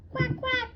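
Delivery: high squeaky duck quacks; silly high quack quack. Acted. Dog makes duck sound.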